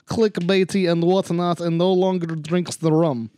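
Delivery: upbeat, loud host opener; bright podcast intro. Acted. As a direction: open the show like a radio host.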